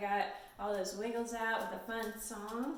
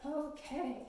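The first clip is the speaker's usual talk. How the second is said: ordinary delivery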